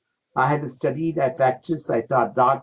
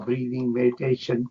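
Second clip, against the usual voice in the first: ordinary talk, nothing marked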